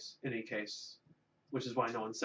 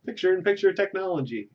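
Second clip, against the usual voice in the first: "picture-in-picture technology" pitched way up, louder; excited announcer; 6 semitones higher, 7 dB louder than usual. Shown as a demonstration. Host promises picture-in-picture next week, excited and high. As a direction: sell the words like a gadget ad. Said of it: "wait, I should be over here".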